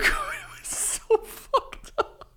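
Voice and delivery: high, wheezing laugh through the words; slow, squeaky, breathless with laughter; helpless laughter. Real, not acted. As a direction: barely get it out for laughing. Cracking up at his own story.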